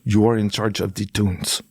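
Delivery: bad Afghan accent. Driver handing over his phone, bad Afghan accent. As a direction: imitate a stern driver in thick Afghan accent. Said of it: "I'm gonna do the bad one".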